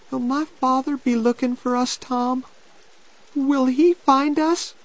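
put-on high, pleading girl's voice; anxious girl. A narrator's reading. Becky hoping her father will search, anxious girl. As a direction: ask anxiously, pitched high.

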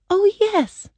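put-on very high girlish voice; high, eager girl's reply; Dorothy. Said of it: "Dorothy said".

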